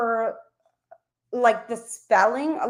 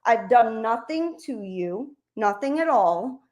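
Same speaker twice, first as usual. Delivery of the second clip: ordinary talk, nothing marked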